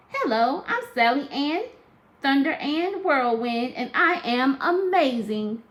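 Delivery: high, loud, proud little voice; bold baby Sally Ann. Acted. Newborn Sally Ann boasting to her family, high and proud. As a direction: announce yourself like a boastful newborn. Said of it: "she just started to talk".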